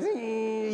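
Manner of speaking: high, sustained whirring zing; drawn-out mechanical whine; imitated film winding. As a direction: imitate a camera rolling its film. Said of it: "you had to hear that".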